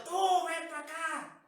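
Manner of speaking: loud, high mock yell; yelling person impression. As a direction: imitate someone who yells all day. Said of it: "todo el día se la pasa gritando".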